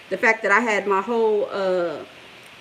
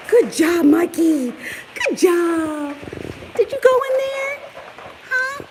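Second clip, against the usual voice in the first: puppy talk; 10 semitones higher, 5 dB louder, slower than usual. Real, not acted. Vlogger praises her puppy Mikey in high baby talk.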